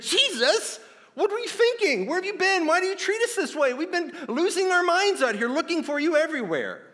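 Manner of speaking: loud, high-pitched frantic outburst; freaked-out mom. Acted. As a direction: freak out like a mother who just found her missing child. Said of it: "She freaked out".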